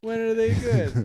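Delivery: loud, high, drawn-out question; mock incredulity. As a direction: demand it slowly in comic disbelief. Acting disbelieving parent hearing praise.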